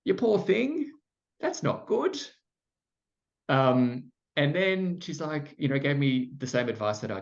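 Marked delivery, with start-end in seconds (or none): 0.0-2.2 high-pitched mock pity, broad Australian; broad Australian accent. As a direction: imitate a pitying counselor with broad Australian vowels. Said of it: "This very broad Australian accent".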